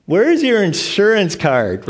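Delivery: slow, loud, pointed clerk's question; a mock receptionist demanding the card slowly; brusque clerk. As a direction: bark it like a bored hospital clerk. Mimicking hospital clerk at check-in.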